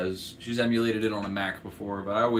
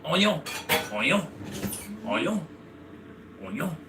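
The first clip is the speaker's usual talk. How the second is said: imitating a French chef saying ONION, with rising pitch; French-accented onion; 5 semitones higher than usual. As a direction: repeat "onion" in a French accent, pausing between each. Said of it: "French chef who says onion".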